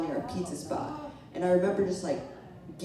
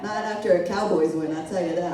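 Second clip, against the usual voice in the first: ordinary talk, nothing marked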